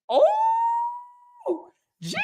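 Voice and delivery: very high, stretched gasp of joy; overwhelmed gasp. Real, not acted. Overwhelmed by donations.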